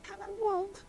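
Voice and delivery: normal talking voice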